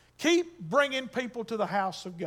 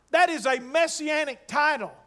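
normal talking voice

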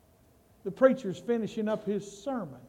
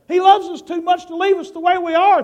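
much higher, loud, stress on loves; emphatic preaching; 9 semitones higher, 10 dB louder than usual. Shown as a demonstration. Pastor driving the point home to the church, loud emphatic preaching. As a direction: preach it out to the congregation with full force.

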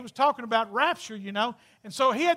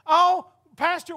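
lady's voice; 5 semitones higher, 6 dB louder, slower than usual. Retold or imitated. Voicing giggling lady.

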